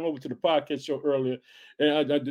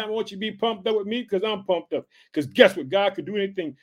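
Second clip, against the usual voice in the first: ordinary delivery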